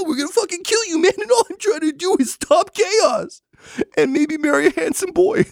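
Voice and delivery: very high, wailing near sobs; tearful unhinged rant. Acted. Framed noble pleading his innocence, high and tearful. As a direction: whine it out on the verge of tears. Accused NPC breaks down ranting.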